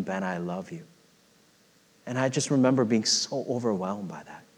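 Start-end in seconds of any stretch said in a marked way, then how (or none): 0.0-0.8 soft, low hushed tender voice; voice of Jesus, softly. Retold or imitated. Preacher repeating Jesus's words to congregation, low and soft. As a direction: say it low and barely there. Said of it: "Jesus saying".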